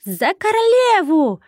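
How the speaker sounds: very high, loud squeaky shout; little trolls' war cry. A narrator's reading. Baby trolls charge for their queen, squeaky war cry. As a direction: stretch out a tiny warrior's rallying cry. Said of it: "прокричал самый первый штроль".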